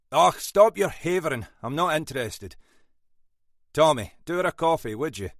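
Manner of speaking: loud, brusque Scots cut-off; impatient Scottish rebuke. A narrator's reading. Boss silencing a late sergeant, impatient Scottish rebuke. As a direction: snap at her to stop havering. Munro cuts West off.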